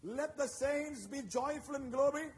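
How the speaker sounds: loud, slow proclaiming of the psalm; preacher thunders the psalm, slow and loud; preaching scripture. Acted. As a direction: declare each line like a battle cry. Reads scripture with force.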